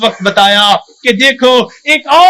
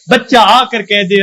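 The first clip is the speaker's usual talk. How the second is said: ordinary talk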